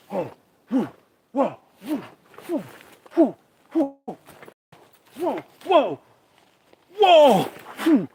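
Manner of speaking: high, loud excited whoas; hammed-up breathless Bigfoot spotter; Todd Standing impression. Imitating a filmmaker spotting Bigfoot, high and hammed-up. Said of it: "Todd Standing impression".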